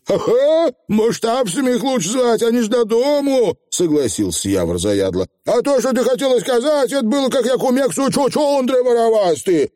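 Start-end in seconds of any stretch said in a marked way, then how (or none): 0.1-3.6 высокий голос маленького человечка, охотно соглашается; говори высоко, как бойкий сказочный фигль; задиристый фигль. A narrator's reading. Озвучивает фигля Явора. 5.4-9.7 высокий голос сказочного коротышки; бойкая высокая скороговорка фигля; задиристый фигль. A narrator's reading. Озвучивает фигля.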